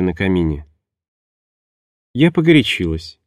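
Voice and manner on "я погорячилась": falsely soft. Acted. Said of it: "деланная мягкость интонации звучала чересчур фальшиво".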